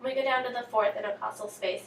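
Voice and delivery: ordinary talk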